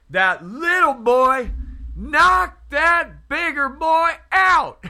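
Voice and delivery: high, emphatic mimicked storytelling, slowing at the end; retold preacher's punchline. Preacher ending his fight story, high mimicked punchline. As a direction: land the story's punchline high, loud and drawn out. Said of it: "he says".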